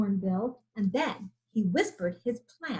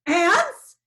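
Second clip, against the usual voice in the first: horrified; 7 semitones higher, 9 dB louder than usual. A narrator's reading. Lion horrified.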